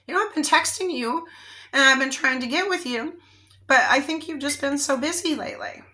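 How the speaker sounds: asking gently what went wrong; hurt, earnest friend. Retold or imitated. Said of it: "you actually ask her".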